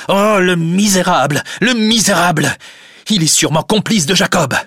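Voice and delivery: high, loud indignation; outraged outburst. Acted. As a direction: curse the villain in acted fury. Cornelius rages at Rosa's father. (explosion de colère indignée)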